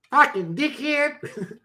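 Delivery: very high, stretched Aussie drawl; Australian accent. Shown as a demonstration. Streamer practising on a viewer, exaggerated Australian accent. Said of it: "You don't sound authentic".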